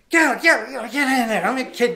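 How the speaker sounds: loud, high, urgent urging; hammed-up pushy parent yelling; frenzied parents. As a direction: push the kid on in a mock frenzy.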